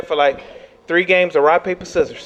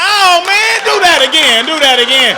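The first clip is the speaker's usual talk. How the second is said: loud, high, thrilled exclamation; amazed excitement; 10 semitones higher, 13 dB louder than usual. Acted. Officer amazed by driver's backflip, shouting excitedly. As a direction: shout it like an astonished fan.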